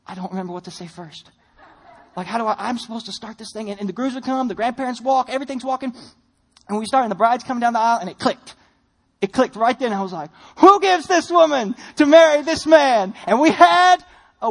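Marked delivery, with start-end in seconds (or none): 0.1-3.7 hushed panic. Retold or imitated. Panicked inner thought. 10.6-13.1 slow, louder, formal wedding call; formal officiant question to the congregation. Retold or imitated.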